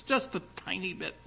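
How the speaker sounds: small, high, soft voice; teasingly tiny. Pretend. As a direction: shrink it playfully, high and quiet. Teasing stoic Scotsmen's small happiness.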